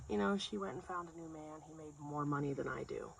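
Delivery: lightly voiced soft-spoken guy; man from group. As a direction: confide it quietly. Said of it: "it's one of the guys".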